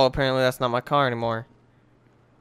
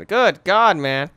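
GOOD GOD higher and louder, dropping on man; emphatic exclamation; 4 semitones higher, 6 dB louder, much slower than usual. Real, not acted. Gamer reacting to his room, loud emphatic exclamation.

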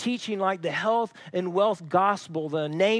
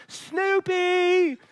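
high, loud frantic calls of a dog's name; panicked shouting of a name; calling loudly; 11 semitones higher, much slower than usual. Retold or imitated. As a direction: call out desperately for the lost dog. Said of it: "This, this loud scream".

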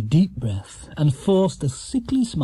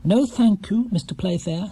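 firm; 7 semitones higher than usual. A narrator's reading. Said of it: "said Paddington firmly".

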